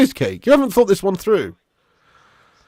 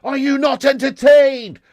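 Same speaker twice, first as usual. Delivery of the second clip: slow, higher, louder Gladiator shout; Maximus Gladiator shout; 8 semitones higher, 5 dB louder, slower than usual. Retold or imitated. Streamer bored by United, shouting as mock Maximus. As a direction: bellow it at the arena like Maximus. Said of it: "I'm actually not Maximus Decimus Ten Hagius".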